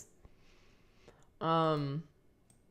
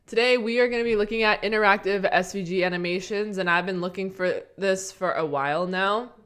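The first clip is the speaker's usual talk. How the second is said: louder upbeat host intro; presenter voice; 2 semitones higher, 7 dB louder than usual. Acted. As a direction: open the show with energy. On-air tutorial intro.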